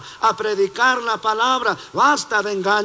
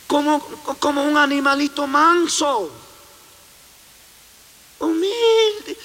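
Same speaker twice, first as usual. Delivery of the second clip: sugary cooing over an innocent little animal, rising much higher; mock sweet; 6 semitones higher than usual. Preacher mocking how sweet the wolf looks, mock cooing. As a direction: coo as if charmed by a harmless pet.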